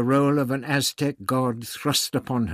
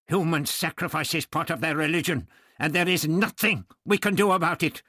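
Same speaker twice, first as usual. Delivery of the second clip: raised, higher voice, exasperated; angry scolding; 9 semitones higher, 4 dB louder than usual. A narrator's reading. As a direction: lay down the facts heatedly. Doctor angrily scolding Barbara.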